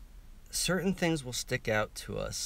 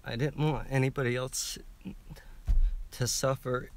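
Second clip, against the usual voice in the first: crying; 3 semitones higher than usual. Real, not acted. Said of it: "I'm gonna start crying".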